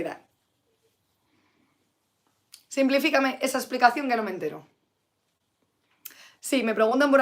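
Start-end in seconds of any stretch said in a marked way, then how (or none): none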